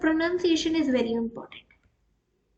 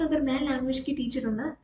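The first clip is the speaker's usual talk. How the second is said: ordinary talk, nothing marked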